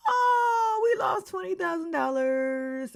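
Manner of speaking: high, drawn-out cartoonish wail; mock wailing. Players learning they lost the prize money, mock wail. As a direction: bemoan it in exaggerated despair.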